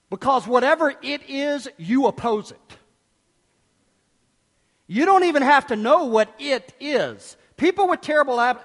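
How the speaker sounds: ordinary delivery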